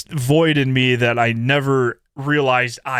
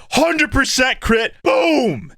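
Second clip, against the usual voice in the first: excited boom; 9 semitones higher, slower than usual. Real, not acted. Hyping the old tactical.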